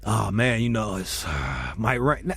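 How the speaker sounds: low mumbling excuse, slow at the end; mock squatter mumble. Homeless guy excusing himself to the homeowner, low mumble.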